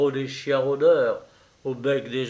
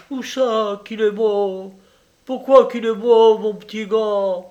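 higher, stricken pleading questions; a mother's disbelieving grief; anguished cry; 8 semitones higher, 7 dB louder than usual. Acted. Peasant mother learning her son is dead, anguished cry.

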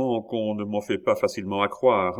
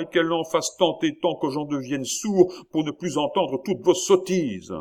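higher, louder, dragging out the last words; still shouting; 8 semitones higher, 4 dB louder than usual. Acted. King raging at his ministers' nonsense, loud angry shout. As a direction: keep yelling, winding up the rant. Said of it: "cria le roi".

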